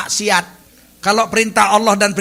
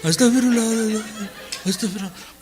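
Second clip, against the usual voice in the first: pretend crying; 4 dB quieter than usual. Shown as a demonstration. Preacher demonstrates forcing tears during repentance, pretend crying. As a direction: fake weepy pleading in a put-on voice. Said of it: "pura-pura nangis".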